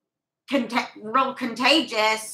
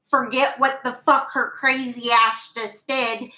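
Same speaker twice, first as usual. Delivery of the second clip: ordinary delivery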